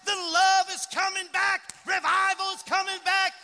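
high, loud revival shout. Retold or imitated. Imitating a woman shouting revival on stage, exultant shout. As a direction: cry it out like a Pentecostal preacher. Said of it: "she's shouting all over the stage".

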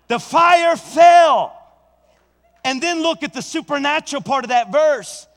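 slow, high, shouted climax; preaching shout. Acted. Preacher to the church, shouted climax. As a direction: shout it out slowly to the rafters.

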